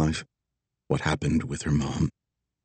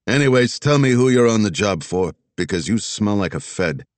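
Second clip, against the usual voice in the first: put-on Philly accent; 2 semitones higher, 3 dB louder than usual. Old Philly cop sizing her up.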